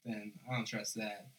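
ordinary delivery

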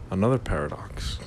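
ordinary talk, nothing marked

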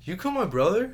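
high, loud parody of a bad actor; hammed-up outraged question; mock accusation. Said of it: "and he's like".

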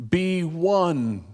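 slow, spacious sermon cadence; preacher's closing meditation, slow and lingering; reflective preaching. Acted. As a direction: let each phrase hang, unhurried.

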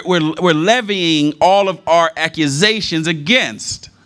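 high, slow, ringing preacher voice, building; full-force preaching. Acted. Preacher urging the church to bring frustrations to God, full-force preaching. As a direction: lift it and hammer each phrase to the congregation.

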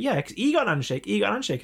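high-pitched aggrieved complaint; acted out as a wronged friend; mock indignant protest. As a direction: protest the unfairness. Acting friend's indignant protest in story.

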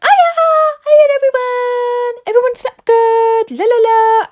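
very high, loud, drawn-out singsong greeting; hammed-up cheerful squeal to the whole team; over-chirpy morning greeting.

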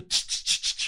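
short repeated "tch" bursts; imitated spray noise. Man suggesting spraying vinegar from afar, imitated squirts. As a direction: mimic squirts from a pump sprayer.

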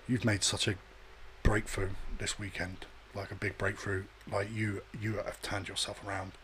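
low, hushed, halting youth leader; imitating earnest counselling. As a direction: softly deliver a mock-solemn pep talk. Youth leader's earnest drive-home talk.

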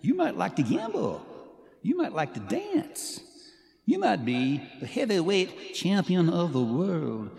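loud, drawn-out swooping parody of a singer; hammed-up bad singer impression, high and loud. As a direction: exaggerate the swoops and stretch the line endings. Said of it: "do a [name] impersonation".

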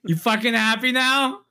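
mock exasperated confession.

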